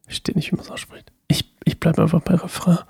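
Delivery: unvoiced whisper, half to herself; sheepish whispered aside. Real, not acted. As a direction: drop to a whisper like a private joke. Aside after pronunciation struggle.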